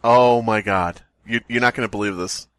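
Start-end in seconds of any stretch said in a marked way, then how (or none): none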